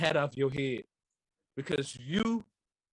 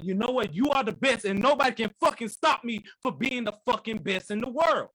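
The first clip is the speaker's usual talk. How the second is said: loud, higher self-hype to the mirror; hammed-up mirror boast, higher and louder; mirror pep talk; 7 semitones higher, 3 dB louder than usual. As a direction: pump yourself up in the mirror. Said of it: "looking in the mirror and saying".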